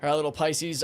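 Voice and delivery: normal talking voice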